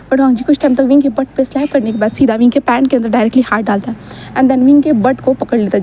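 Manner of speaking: ordinary delivery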